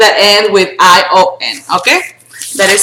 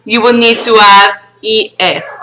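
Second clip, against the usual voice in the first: normal talking voice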